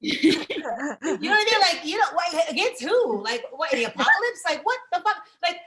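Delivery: exasperated rant. Acted. Comic to audience, a loud incredulous rant. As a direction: throw it at them like it's absurd.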